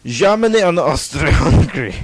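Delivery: hammed-up French accent. Shown as a demonstration. Student presenting the alliances as a joke, in a French accent. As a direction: put on a silly French accent. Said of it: "That was a French accent".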